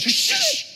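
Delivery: sudden high sharp shush. Retold or imitated. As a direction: hush him with a sharp shh. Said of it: "she goes".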